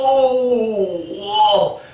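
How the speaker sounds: long, loud, high groan of agony; mock pained howl. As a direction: howl as if just kicked, hammed-up. Acts kicked man's agonised reaction.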